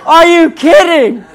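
drawn-out, high, loud playful yell; mock outrage. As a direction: shout it slowly in mock disbelief. Wife takes his planned example.

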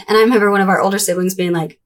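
ordinary talk

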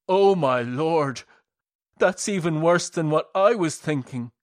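clutch your mouth in mock horror; horrified. A narrator's reading. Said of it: "covered his mouth in horror".

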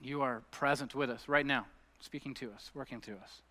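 hushed, unhurried congregational prayer; leading prayer. Real, not acted. Leads closing prayer.

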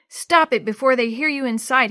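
loud, urgent order, lightly hissed; mock hiss. A narrator's reading. Tevin silencing Jenny in the shed, loud urgent hiss. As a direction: urgently cut off the screaming. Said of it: "he hissed".

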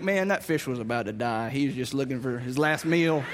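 low, wry teasing; joking dad. Retold or imitated. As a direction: tease the kid about his catch.

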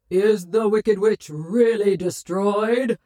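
slow, grand, echoing question; booming wizard voice. A narrator's reading. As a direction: ask it as the mighty Oz. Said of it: "asked the voice".

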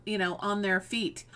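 ordinary talk

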